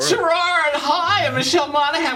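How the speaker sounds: high, loud, gushing hello; excited greeting. Real, not acted. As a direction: greet him with bubbly enthusiasm. Excited greeting on red carpet.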